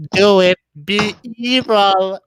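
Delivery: slow, sinister tempter voice; drawn out evil command; sinister Palpatine. Retold or imitated. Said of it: "Palpatine's literally just like".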